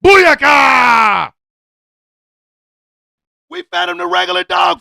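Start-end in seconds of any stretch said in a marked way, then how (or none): 0.0-1.3 loud hammed-up battle cry for the dog; loud playful exclamation; mock dog shout. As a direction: shout it like the dog's war cry.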